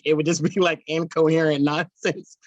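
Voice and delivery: normal talking voice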